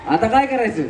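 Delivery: ordinary delivery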